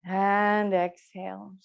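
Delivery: slow, stretched exhale cue; drawn-out breath cue. Real, not acted. Yoga teacher pacing the class's exhale, slow and drawn out. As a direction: stretch the words to last a long breath.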